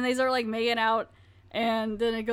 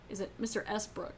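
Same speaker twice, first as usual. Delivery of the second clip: ordinary delivery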